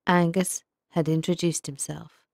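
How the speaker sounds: ordinary delivery, nothing marked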